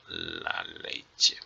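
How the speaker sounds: very low, soft, drawn-out murmur; murmured while writing. Real, not acted. Teacher writing la leche by hand, murmured slowly. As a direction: sound it out under your breath, slowly.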